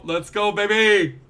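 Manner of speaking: loud, drawn-out victory call; triumphant whoop. Real, not acted. Gamer hyping himself up after the catch, triumphant whoop. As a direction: stretch it out, hyped and loud.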